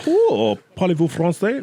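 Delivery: high mock-flirty French question; imitating the ladies. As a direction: swoon at him in French. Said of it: "they're like".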